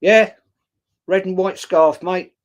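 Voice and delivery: mock thug calling him out; imitating a fan who spots the scarf; gotcha, triumphant. Thug on train catches him out.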